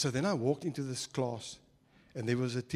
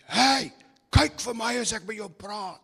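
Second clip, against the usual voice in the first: higher, louder barking teacher voice; stern teacher; 7 semitones higher, 6 dB louder than usual. Retold or imitated. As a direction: snap at the giggling pupil. Short teacher scolds laughing pupil.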